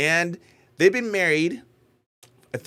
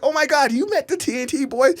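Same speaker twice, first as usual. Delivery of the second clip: high, louder lightly voiced star-struck gasp; amazed relatives; 11 semitones higher, 5 dB louder than usual. Relatives learning he met the TNT boy, lightly voiced high amazement. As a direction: lightly act out their disbelief. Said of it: "And then they're like".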